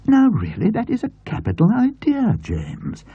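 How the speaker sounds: put-on high, enthusing elderly aunt; aunt's voice. A narrator's reading. Aunt delighting over the fabric to James, enthused. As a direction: gush over the pattern as the aunt.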